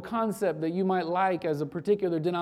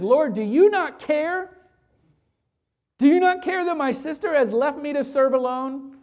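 higher and louder, an accusing cry; angry outburst; 6 semitones higher, 6 dB louder than usual. Retold or imitated. Martha, overworked, accusing Jesus about her idle sister, in an angry outburst. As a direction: let the hurt boil over at Jesus. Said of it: "in an outburst of anger".

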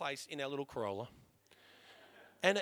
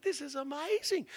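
high-pitched retold elation; amazed; 9 semitones higher than usual. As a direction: gush at wonderful news, voice way up. Reacts to wife's news of car.

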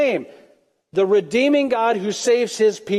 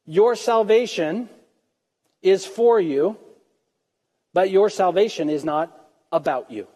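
ordinary talk

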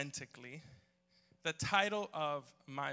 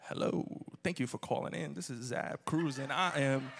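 smooth R&B phone voice; 3 semitones higher, 5 dB louder than usual. Retold or imitated. Colleague answers a work call, R&B host voice. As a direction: croon the greeting, then gush, imitating a colleague. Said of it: "called into some R and B".

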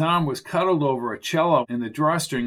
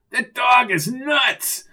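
high, drawn-out cry of alarm; high-pitched panicky exclamation; alarmed yell; 9 semitones higher, 4 dB louder, slower than usual. Acted. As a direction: yell it slowly in a high voice, rattled. Arnie flees the lunging dog.

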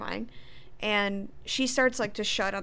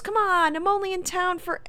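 high, acted plea cut short; pleading; 10 semitones higher, 4 dB louder than usual. Sean talking as they shut him out, pleading. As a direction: plead through a closing door, pitched up.

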